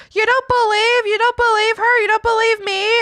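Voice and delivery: high, loud hurt mom voice; offended mom. Retold or imitated. Mom protesting to her daughter, high and hurt. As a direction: whine like a wounded mother.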